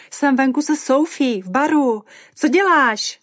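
loud high shriek, climbing at the end; Mimi. A narrator's reading. Tipsy friend on the phone from a bar, shrieking. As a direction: yell excitedly from the bar. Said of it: "zajičela Mimi".

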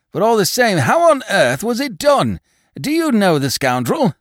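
high, loud, indignant questioning; agitated demand. A narrator's reading. Robbed man questions the inspector, agitated. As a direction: demand answers in astonishment.

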